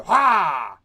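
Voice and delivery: a sudden loud "Ha!" as the mouth pops open; loud snap "Ha!". Shown as a demonstration. Animation teacher voicing the quick mouth pop, loud sudden "Ha!". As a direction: bark it out to show the snap. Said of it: "then it's just gonna go".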